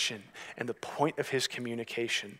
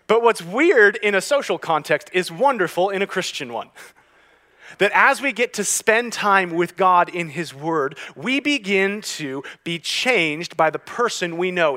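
loud, raised-pitch preaching; impassioned preaching; 7 semitones higher, 11 dB louder than usual. Real, not acted. Preacher applying his quirk to life with God, impassioned. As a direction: drive the point home to a congregation.

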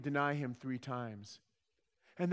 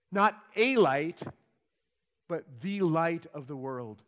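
Not a light punched loud and slow, then the light of the world; emphatic contrast; 5 dB louder, slower than usual. Acted. Preacher stressing Jesus' claim to the crowd, emphatic contrast.

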